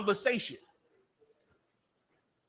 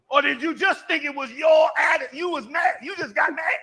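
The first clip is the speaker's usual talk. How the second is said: raised, high-pitched challenging questions; incredulous challenge; 6 semitones higher than usual. Real, not acted. Preacher challenges congregation with mocking questions.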